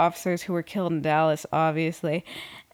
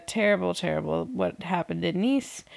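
ordinary delivery

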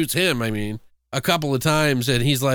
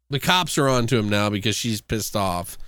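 ordinary talk

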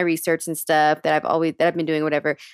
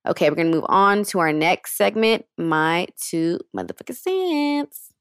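ordinary delivery

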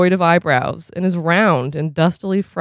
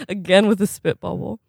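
usual speaking voice

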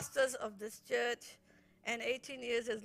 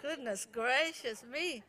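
ordinary talk, nothing marked

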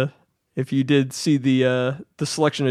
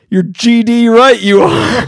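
loud, sudden, over-the-top retorted agreement; oddly aggressive; 8 semitones higher, 7 dB louder than usual. Retold or imitated. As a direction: bark it back with surprising aggression. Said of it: "him in a very oddly aggressive tone goes".

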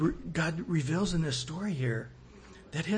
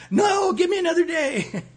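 much higher and louder, in a mock panic; hammed-up sinner begging for one more day before the rapture; panicked plea; 12 semitones higher, 8 dB louder than usual. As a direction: cry out like someone caught unready.

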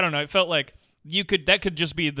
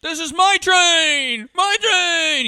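high, loud, slow territorial bellow; hammed-up angry ghost yell; mock furious yell; 13 semitones higher, 8 dB louder, much slower than usual. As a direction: roar it like he owns the train. Imitating the subway ghost yelling.

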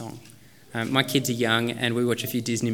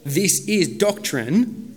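very slow, drawn out, a little higher; slow mock label; 5 semitones higher, 4 dB louder, much slower than usual. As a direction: spell it out like a heading.

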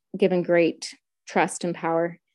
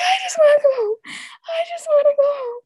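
high, loud wailing sob; crying; 4 dB louder than usual. Retold or imitated. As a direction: wail it through tears to the officer. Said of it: "I was like".

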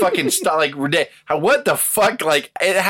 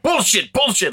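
higher, yelling bullshit at the ring; angry shout; 7 semitones higher than usual. Retold or imitated. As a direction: bark it like an angry boss at the rail. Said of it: "shaking the guardrail".

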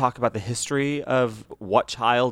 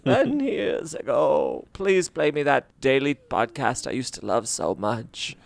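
slow, put-on aged voice; mock old-timer; slower than usual. Host begging for the old daily podcast, mock old-timer. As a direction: reminisce slowly like a pretend ancient listener.

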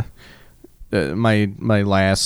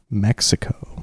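imitated boss whispering in disbelief; disbelieving whisper; slower than usual. Boss queries employee's country list, whispered disbelief. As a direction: whisper it as a stunned question. Boss's disbelief, acted in story.

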